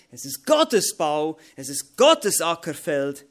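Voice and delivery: loud, slow sermon emphasis; forceful preaching. Acted. Preacher proclaiming to the church, loud and slow. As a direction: hammer each phrase home to the congregation.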